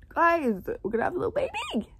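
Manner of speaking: high, fast gushing; excited squeal. Real, not acted. Fan telling viewers about the baby, squealing. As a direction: squeal with joy.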